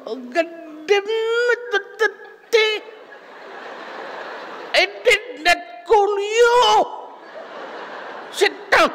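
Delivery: imitating angry teacher; shrill old professor. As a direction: bark at the student in a shrill high voice. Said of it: "boses, ano, siya, shrill".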